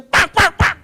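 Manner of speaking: loud high "pak, pak" slaps; loud mock slapping noises; imitated double slap. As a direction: clap the sounds out sharply. Slapping three mosquitoes.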